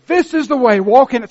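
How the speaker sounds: high, loud declaration; proclaimed voice. Retold or imitated. Pastor proclaiming God's guidance, loud and high. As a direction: call out the way boldly. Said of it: "this small voice says".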